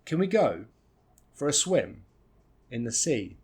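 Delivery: pauses after "go" and "swim"; phrase by phrase, stressing GO, SWIM, SEA; three chunks. Shown as a demonstration. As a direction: break it up: "Can we go", "for a swim", "in the sea". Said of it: "three nice chunks here".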